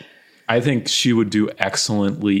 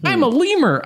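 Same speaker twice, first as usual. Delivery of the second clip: silly high voice; 4 dB louder than usual. Pretend. Silly character voice game.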